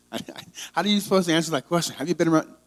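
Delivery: can't believe they ask this; exasperated disbelief. Real, not acted. To the listeners, mocking the question in disbelief.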